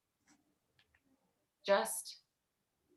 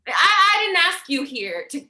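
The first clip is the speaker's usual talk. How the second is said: angry outburst; 5 semitones higher, 5 dB louder than usual. Acted. Heated argument between brothers.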